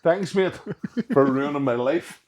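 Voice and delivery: high, loud mock gratitude, dripping sarcasm; retelling a sarcastic thank-you to the retired strongman. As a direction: thank him like he ruined everything. Sarcastic reply to his blunt diagnosis.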